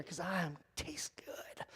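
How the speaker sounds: unvoiced, barely audible whisper; whispered aside. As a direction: drop it as a sly secret. Joke about being shark food.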